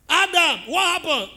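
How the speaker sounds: loud, high divine question to Adam; God calling Adam. Retold or imitated. Preacher voicing God questioning Adam, loud and high. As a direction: call out to Adam like God demanding an answer. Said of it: "reminding me about the snake".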